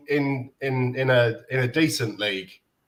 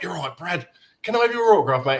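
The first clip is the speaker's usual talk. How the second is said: mock Australian accent, pitched higher; a playful stab at an Australian accent, voice pushed higher; 7 semitones higher, 3 dB louder than usual. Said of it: "I was just about doing an Australian accent".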